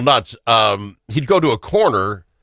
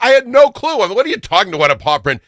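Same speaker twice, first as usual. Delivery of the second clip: higher-pitched, incredulous retelling of a protest; exasperated retort; 12 semitones higher, 3 dB louder, faster than usual. Retold or imitated. Dog owner retelling his reply to a paw print offer, exasperated. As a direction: protest in mock disbelief, pitch climbing.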